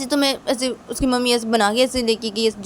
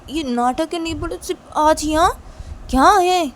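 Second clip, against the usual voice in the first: scolding boss; 5 semitones higher, 4 dB louder than usual. Pretend. Boss refuses the maid.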